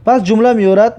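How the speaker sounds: normal talking voice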